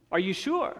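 ordinary talk

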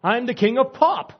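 high, loud proclamation of a title; puffed-up bragging, loud and high; mock boast. Voicing a pop star's boast.

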